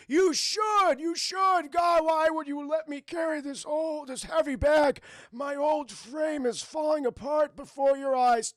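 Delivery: high, loud, whining grandpa; Aang as old man. Retold or imitated. Fake grandfather complaining about the heavy bag, hammed-up wail. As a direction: wail slowly like a hammed-up frail elder. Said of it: "Aang's like".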